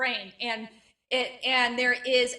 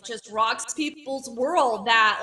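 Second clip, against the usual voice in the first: ordinary talk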